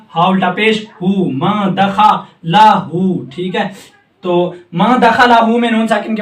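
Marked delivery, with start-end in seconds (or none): 1.0-3.3 "मा दखाला हू" stretched very slowly; slow recitation. Shown as a demonstration. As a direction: draw out "मा दखाला हू".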